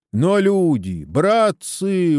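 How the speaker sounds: high, drawn-out appeal; pleading exhortation. A narrator's reading. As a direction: plead with the crowd to see reason. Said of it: "увещевает староста". (увещевая, протяжно и высоко)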